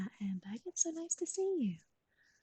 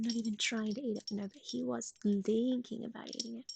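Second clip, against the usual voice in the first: ordinary talk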